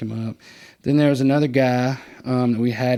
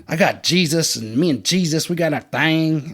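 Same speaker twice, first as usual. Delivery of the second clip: haughty; 5 semitones higher, 4 dB louder than usual. Retold or imitated. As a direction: imitate a smug self-righteous convert. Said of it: "he was just real haughty".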